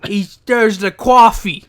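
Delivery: slow, loud hammed-up New York accent; mock New York accent. Co-host mocks the wrestler's attempt, in a hammed-up New York accent. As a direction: ham up a bad New York coffee. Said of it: "a New York accent".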